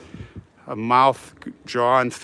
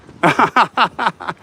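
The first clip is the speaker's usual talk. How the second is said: loud, higher-pitched burst of laughter; hearty laugh; 6 semitones higher, 5 dB louder than usual. Real, not acted. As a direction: laugh out in a quick run of ha-has. Joking with a passerby.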